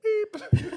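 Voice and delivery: high, loud imitated beep; answering machine beep. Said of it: "the answering machine would be like".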